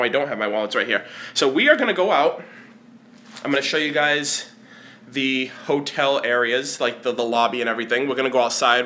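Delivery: normal talking voice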